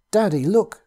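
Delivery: put-on high, loud child's cry; screeched. The boy alerting his father, screeching. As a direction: shriek it out in alarm. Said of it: "screeched the boy".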